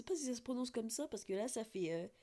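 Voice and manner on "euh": normal talking voice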